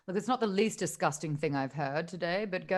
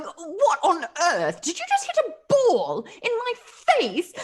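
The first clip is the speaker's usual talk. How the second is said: startled shriek; 11 semitones higher, 5 dB louder than usual. Acted. GM voices startled Claudius.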